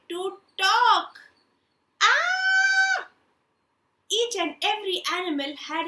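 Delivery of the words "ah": high loud frantic scream of a man at wits' end; frustrated scream. Acted. Man driven mad by animal noise, shrieking. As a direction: shriek like you can't take the noise.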